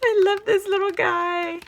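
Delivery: very high, near crying over a cute die; tearful squeal. Real, not acted. Vlogger coos at a little elf gift, near tears. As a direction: coo with happy tears. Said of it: "I'm so excited".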